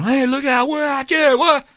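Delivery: high-pitched mock yelling from the plane; retold screaming. Panicked passengers spotting a man on the runway, high mock screams. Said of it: "people start screaming".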